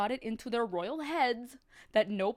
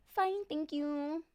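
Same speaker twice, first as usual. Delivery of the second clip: slow, polite princess; 5 semitones higher, much slower than usual. Acted. Princess answers the queen slowly and politely. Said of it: "The princess would politely say".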